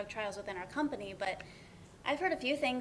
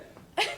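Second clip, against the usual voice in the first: a quick, higher burst of laughter; short laugh; 12 semitones higher, 4 dB louder than usual. Real, not acted. [name] laughing at Ben's fish story, short and bright. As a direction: laugh out at the joke.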